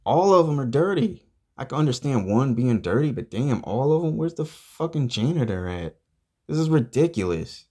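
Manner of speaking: high-pitched, exasperated rant; indignant high-voiced outburst; outraged complaint. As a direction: protest in rising disbelief at the mess. Ranting about dirty tables.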